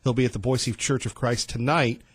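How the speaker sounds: usual speaking voice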